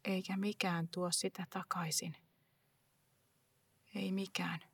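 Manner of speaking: whispered, hollow loss; quiet whisper of loss; grieving whisper. Acted. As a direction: barely voice it, as if to yourself. Grief after mother gone.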